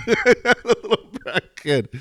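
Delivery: very slow, high, laughing sneer; laughing scorn. Real, not acted. Echoing his friend's jab at the kid, slow laughing sneer. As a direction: drag it out through laughter.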